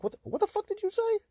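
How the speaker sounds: squeaky high shocked retort; outraged disbelief. Retold or imitated. Speaker retelling reply to dad, high shocked disbelief. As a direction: shriek it in disbelief. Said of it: "I'm like".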